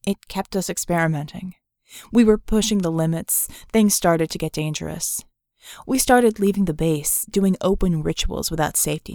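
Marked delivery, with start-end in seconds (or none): none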